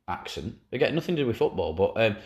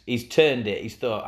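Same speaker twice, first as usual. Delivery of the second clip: ordinary talk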